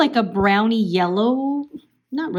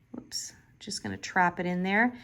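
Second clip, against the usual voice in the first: whispered aside; 2 semitones lower, 6 dB quieter than usual. Real, not acted. Quick aside over a slip.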